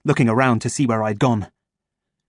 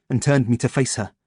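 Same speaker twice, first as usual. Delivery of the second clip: ordinary delivery, nothing marked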